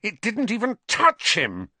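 high, indignant protest; angry. A narrator's reading. Harry protesting to Ernie, angry. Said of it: "his voice shaking with anger".

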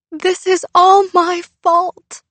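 high, loud, slow sobbing confession; weeping guilt. Acted. Girl blames herself for her dog's death, weeping. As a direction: sob out the blame.

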